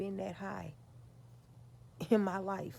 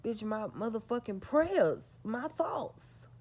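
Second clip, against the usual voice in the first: higher, louder, slow and indignant; loud retort; 4 semitones higher, 7 dB louder, slower than usual. Real, not acted. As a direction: snap back at her.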